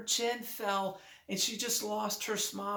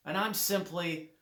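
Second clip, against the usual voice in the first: ordinary talk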